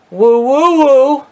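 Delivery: high, slow, drawn-out woo woo woo; mock woo. Vlogger hamming up a wrestler's cheer, high and drawn out.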